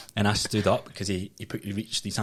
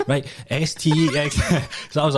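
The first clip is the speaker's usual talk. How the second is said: laughing; 7 semitones higher, 4 dB louder than usual. Real, not acted. Laughing while retelling the plate test.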